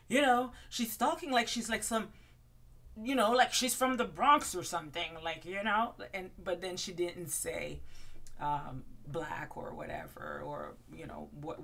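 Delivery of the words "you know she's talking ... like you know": higher and louder, flustered put-on voice; mock Ramona backpedalling. Ramona backpedalling at the bar, loud and flustered. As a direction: back out of it loudly like a flustered diva.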